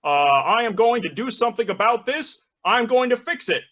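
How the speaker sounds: hesitate, then promise it like a politician; Barack Obama. Acted. Obamas answer the bartender, halting presidential assurance. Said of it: "The Barack Obamas go".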